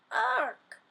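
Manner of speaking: sharp high startled "Ark!"; a sudden squawk as her head thunks. A narrator's reading. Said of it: "she said".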